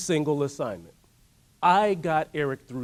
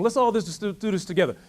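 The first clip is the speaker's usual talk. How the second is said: ordinary delivery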